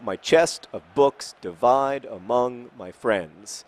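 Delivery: "my chest of books divide among my friends", slow, every second syllable stressed; iambic stress. Shown as a demonstration. Giving viewers an example line, "my chest of books divide among my friends" in slow iambs. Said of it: "you emphasize the second syllable".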